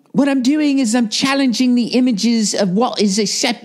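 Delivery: high, pretentious artist voice; mocking a pop star defending herself, high. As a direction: sound self-important. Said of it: "quoting [name] mockingly".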